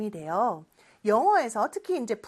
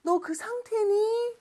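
mock question; 10 semitones higher than usual. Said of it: "라는 질문이 돼요".

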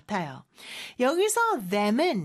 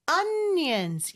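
"onions" with the first syllable stressed and higher; 5 semitones higher than usual. Shown as a demonstration. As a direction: punch the ON in "onions". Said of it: "이름절에 강세가 있어요".

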